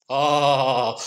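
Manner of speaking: plain reading voice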